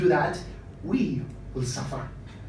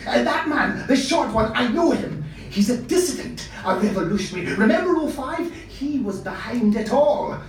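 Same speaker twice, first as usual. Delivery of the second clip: high, loud outburst of recognition; calling out; 6 semitones higher, 4 dB louder than usual. Acted. Costumier calls to his young assistant, loud and urgent. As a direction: call out urgently to your assistant. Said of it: "he called out to his young assistant".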